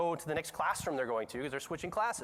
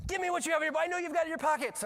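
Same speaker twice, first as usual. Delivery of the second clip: high-pitched mock interrogation; imitated teacher's demand; 11 semitones higher, faster than usual. Third teacher towering over girl, high imitated demand. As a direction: order it like a looming teacher. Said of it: "a third teacher comes up".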